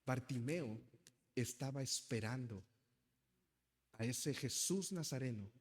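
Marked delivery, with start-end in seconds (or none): none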